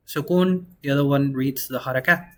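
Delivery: usual speaking voice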